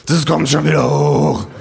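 put-on drunken bawl. A narrator's reading. Narrator drunk at bar bawls at the men. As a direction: bellow it across the bar. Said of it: "gröhl ich".

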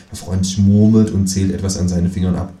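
ordinary delivery

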